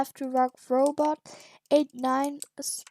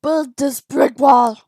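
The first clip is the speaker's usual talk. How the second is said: much louder, slow barked command; yelled order; 12 dB louder, slower than usual. Acted. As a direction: yell the order across the room, slowly. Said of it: "you just yelled at us".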